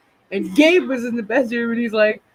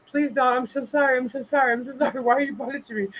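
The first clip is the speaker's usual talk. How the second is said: higher, softer mock pleading; pleading dog voice; 5 semitones higher, 4 dB quieter than usual. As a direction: beg like a scared dog, softly and a bit high.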